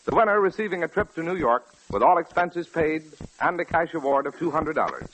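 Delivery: ordinary talk, nothing marked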